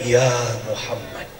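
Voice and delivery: dreamy drawn-out calling in one's sleep; mock sleep-talking. Wife sleep-talking, calling the Prophet, mock dreamy. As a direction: murmur the names like a wife dreaming. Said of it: "Ibu ngigonya begini".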